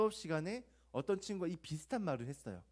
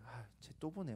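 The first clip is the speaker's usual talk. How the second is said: a soft, lower muttered aside; inner grumble; 4 semitones lower, 8 dB quieter than usual. Retold or imitated. The same hypocrite thinking about the guest, quiet and lower. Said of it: "속으로는".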